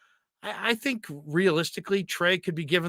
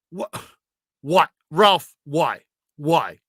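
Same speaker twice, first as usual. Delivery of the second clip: disgusted; 5 dB louder, slower than usual. Retold or imitated. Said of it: "Brian's just disgusted".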